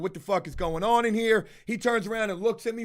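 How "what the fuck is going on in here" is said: ordinary talk, nothing marked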